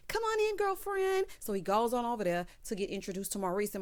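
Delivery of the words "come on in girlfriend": high, singsong greeting voice; effusive welcome. Retold or imitated. Calvin greets his friend with a kiss, effusive.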